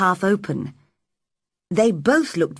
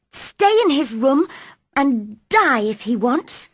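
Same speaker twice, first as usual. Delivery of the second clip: lightly voiced girl, a touch higher and sulky; sulky girl's thought; 7 semitones higher than usual. Mary thinking angrily about the sick boy. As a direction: mutter it to yourself in a sulk. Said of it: "she thought".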